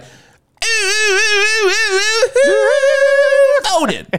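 long, very high rock-singer oohs. Acted. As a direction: wail in falsetto like a frontman. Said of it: "when [name] voted".

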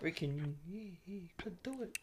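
unvoiced whisper. Real, not acted. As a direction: whisper it, coaxing. Coaxing under his breath.

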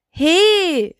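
high, sharp little witch's "Hey"; calling out. A narrator's reading. Little witch protests at the cheeky toe, calling out. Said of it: "rief die kleine Hexe".

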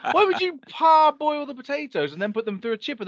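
mock the seller's advice in a high outraged voice; incredulous retort. Retelling his reply to the seller, high and incredulous. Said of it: "I'm like".

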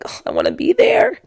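very high, plaintive little voice; mock whiny longing. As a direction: whine playfully at being left out. Retells longing to join them.

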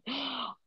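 sharp mock-panicked intake of breath; mock gasp. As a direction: gasp as if caught out. Retelling her panicked reaction.

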